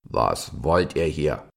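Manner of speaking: slow, severe stag; stern voice. A narrator's reading. Giant stag confronts two young deer, stern. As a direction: challenge them slowly and sternly. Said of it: "fragte eine strenge Stimme".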